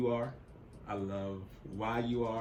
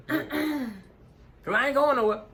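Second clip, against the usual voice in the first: playful high exclamation; 11 dB louder, faster than usual. Real, not acted. Playful line after ring fits.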